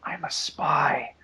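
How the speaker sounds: slow breathy whisper, right in the ear. Retold or imitated. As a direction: whisper it slowly like a secret. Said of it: "she goes into my ear".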